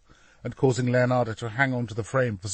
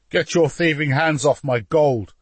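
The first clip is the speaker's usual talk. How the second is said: raised, louder snarl of a duke; angry snarl; 5 semitones higher, 7 dB louder than usual. A narrator's reading. Duke to soldiers helping gather his gold, snarling. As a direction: warn them off angrily, voice pushed up. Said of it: "he snarled".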